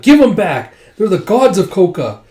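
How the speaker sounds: high, loud battle cry; retold outraged yell; acted angry demand. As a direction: demand them back at a shout. Outraged soldiers demand dragons back.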